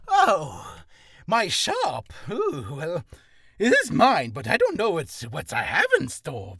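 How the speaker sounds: a dithering merchant who barely knows his stock; clueless shop merchant. Acted. Merchant confused about what he sells, loud and dithering. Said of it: "like the clueless shop".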